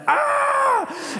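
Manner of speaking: sudden high scream of fright; startled scream. Retold or imitated. Waking to something under the curtains, a startled shriek. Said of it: "and I'm like".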